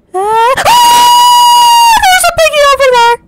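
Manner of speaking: shrieking very high and loud, words rushed; terrified scream. Real, not acted. Startled by the piggy, screaming in panic. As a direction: scream it out in fright.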